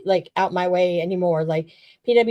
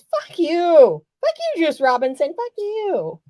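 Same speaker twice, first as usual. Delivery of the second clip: heckling shout, climbing higher; animated heckling yell; 7 semitones higher, slower than usual. Retold or imitated. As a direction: yell it at the ring like a rabid fan. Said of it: "Yeah, I was very animated".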